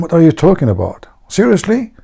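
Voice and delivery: lightly acted astonished friend; mild mock disbelief.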